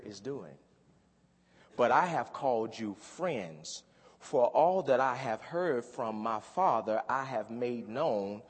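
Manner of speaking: slow, measured reading of scripture; slow solemn Bible reading. Acted. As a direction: lay out each verse slowly and deliberately. Reads scripture aloud.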